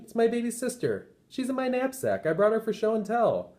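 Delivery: put-on a little boy matter-of-fact to his teacher; small boy. Ben answers the teacher.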